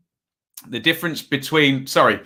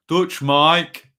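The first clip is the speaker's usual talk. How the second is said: Brummie accent; 4 semitones higher than usual. Shown as a demonstration. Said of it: "I tried it".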